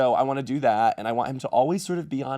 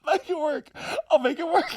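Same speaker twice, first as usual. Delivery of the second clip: squeaky laugh. Real, not acted.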